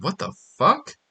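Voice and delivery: a touch of stunned mock outrage; mock disbelief. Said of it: "And you're like".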